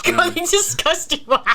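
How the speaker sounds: high, drawn-out mock disbelief; incredulous. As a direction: exclaim it slowly to a clueless friend. Retold disbelief at friend's niceness.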